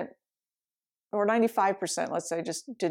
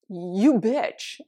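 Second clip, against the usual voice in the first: mock insult; 5 dB louder than usual. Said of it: "that's really different".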